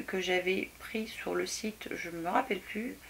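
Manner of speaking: murmured aside about the website; whispered aside. Real, not acted. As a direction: drop to a whisper while searching her memory. Aside while trying to remember.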